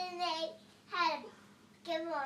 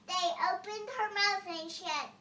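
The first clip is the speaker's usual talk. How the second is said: ordinary talk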